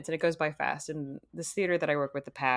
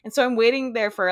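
usual speaking voice

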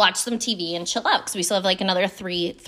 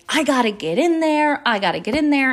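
frantic; 4 semitones higher than usual. Retold or imitated. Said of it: "like, frantic".

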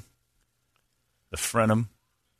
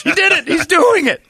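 high excited exclaiming; 4 dB louder than usual. Real, not acted. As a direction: cheer the dog on. Excited about the dog.

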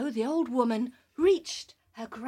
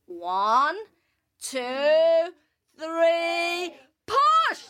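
rising, louder to a high shout; building count to a shout; 5 semitones higher, 4 dB louder, much slower than usual. Acted. Counting to push.

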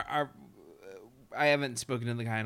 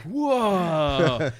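long loud "whoa", pitched up; wowed, drawn-out exclamation; acted amazement; 5 semitones higher, 10 dB louder than usual. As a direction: play blown away by it all. Acting hair blown back by headlines.

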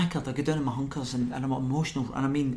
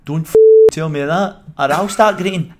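loud, higher mock Yorkshire outburst; big Yorkshire accent; 4 semitones higher, 14 dB louder than usual. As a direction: bellow it, imitating a big Yorkshireman. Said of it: "in a big Yorkshire accent".

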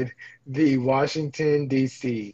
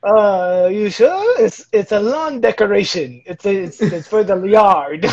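higher, louder coaxing salesman; pushy France; 4 semitones higher, 4 dB louder, slower than usual. Acted. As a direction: push the lawn decoration hard, half laughing. France pushing the statue.

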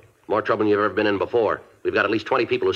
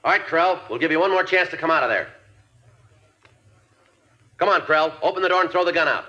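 calling out; 8 semitones higher than usual. Acted. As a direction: project it to the man behind the door. Calling through barricaded door.

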